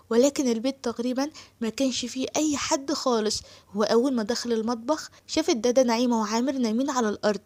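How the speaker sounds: plain reading voice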